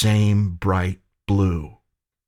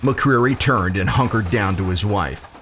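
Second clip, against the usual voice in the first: ordinary delivery, nothing marked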